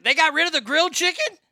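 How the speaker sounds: high, loud squeak of disbelief; voice shot up. Real, not acted. Hearing KFC dropped grilled chicken, squeaky high disbelief. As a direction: voice jumps way up in shock. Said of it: "I don't know why my voice went up like that".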